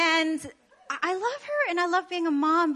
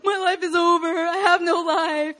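hammed-up wailing sob, pitched up; breaking down in tears; 4 semitones higher, 3 dB louder, slower than usual. New mom acting out her meltdown, tearful wailing. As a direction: wail it as mock crying. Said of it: "I'm breaking down in tears".

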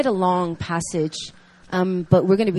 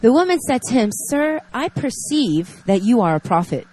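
reading scripture; 4 dB louder than usual. Acted. Reads scripture passage aloud.

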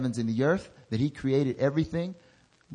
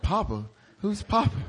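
higher, baffled question; puzzled surprise; 7 semitones higher than usual. Retold or imitated. Pastor to Noberto, surprised and puzzled. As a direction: toss it back, confused. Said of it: "I'm like".

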